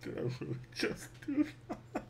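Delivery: unvoiced, hushed stunned question; stunned whisper at own mistake; whispered disbelief. Real, not acted. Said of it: "repeats it laughing".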